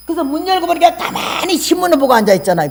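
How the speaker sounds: usual speaking voice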